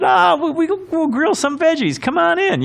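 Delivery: high, hearty invitation from a partying neighbor; jolly party neighbor. Retold or imitated. Neighbor at a late birthday party inviting him over, high and hearty. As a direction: boom it out like a cheery guy with a beer.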